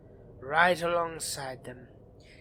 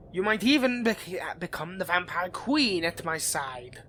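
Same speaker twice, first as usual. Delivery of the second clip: ordinary talk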